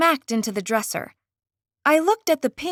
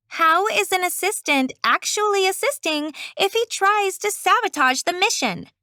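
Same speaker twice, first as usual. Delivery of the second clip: put-on griping girl voice; 6 semitones higher than usual. Fairy girl griping on phone.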